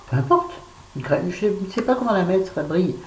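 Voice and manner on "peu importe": high sing-song. Real, not acted. Repeats phrase, second much higher.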